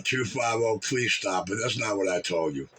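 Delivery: ordinary delivery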